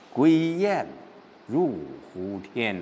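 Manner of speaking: slow recitation sinking at the end; drawn-out, subdued verse; pent-up gloom. Shown as a demonstration. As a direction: recite broodingly, letting the last words drop. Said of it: "这还是在郁闷当中".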